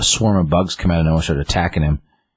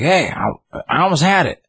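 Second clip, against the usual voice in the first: imitating old man; 12 semitones higher than usual. Old Gramps voiced in recap.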